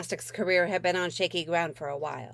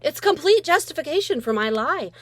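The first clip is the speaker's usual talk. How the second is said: usual speaking voice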